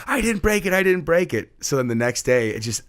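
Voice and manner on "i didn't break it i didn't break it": much higher, louder insistent denial; swearing up and down. Retold or imitated. Kid insisting to the class he didn't do it, high pleading. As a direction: plead your innocence. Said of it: "he swore up and down".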